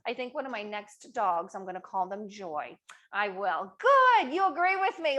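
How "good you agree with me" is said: a high, loud, bright-eyed "Good"; delighted cheer to viewers; excited praise. Real, not acted. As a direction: burst out pleased at the chat. Host cheers on chat replies.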